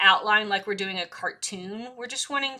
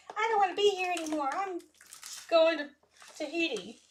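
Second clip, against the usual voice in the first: pen's voice; 8 semitones higher than usual. Pretend. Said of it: "just was like".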